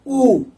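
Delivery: "U" as a long "oo". Shown as a demonstration. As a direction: say "U" as the French ou vowel. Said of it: "U pronunciation".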